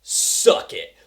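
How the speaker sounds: cocky taunt. Retold or imitated. Fighter taunting the villain, voiceless cocky jeer. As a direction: spit it out like a trash-talking fighter. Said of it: "Dutch is like".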